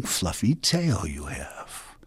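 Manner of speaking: hushed, slow whisper of longing; whispered envy. A narrator's reading. Coyote whispering to himself about the tail. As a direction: breathe it out enviously.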